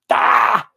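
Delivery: a real, loud, high shout of frustration; frustrated shout. Real, not acted. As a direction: let out a burst of exasperation. Venting about Brexit and the government.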